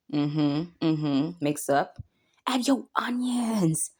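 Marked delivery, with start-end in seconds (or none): 2.5-3.9 soft, slowish whisper; whispered instruction. Real, not acted. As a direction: hush it as you add.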